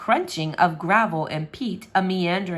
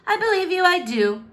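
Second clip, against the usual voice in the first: declaring; 10 semitones higher, 5 dB louder than usual. Acted. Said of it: "Pugly declared".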